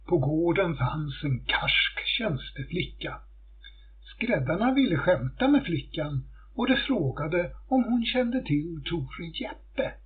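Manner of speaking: usual speaking voice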